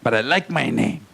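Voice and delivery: ordinary talk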